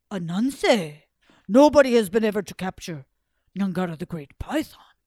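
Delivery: loud, booming amazement; astonished Sky God. Acted. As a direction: proclaim it loudly in astonishment.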